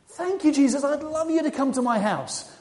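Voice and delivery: thrilled acceptance of a guest; delighted. Acted. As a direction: gush gratefully, pitch lifted. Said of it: "Zacchaeus was delighted".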